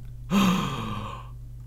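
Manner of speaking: long dramatic gasp of shock. Acted. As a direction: gasp as if stunned. Mock shock for clickbait thumbnail.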